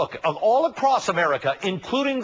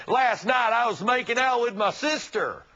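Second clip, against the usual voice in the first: higher-pitched, put-on Southern accent; mock Southern accent; 5 semitones higher than usual. Host parodying a Southerner to expose a double standard, mock Southern accent. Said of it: "say in a mock Southern accent".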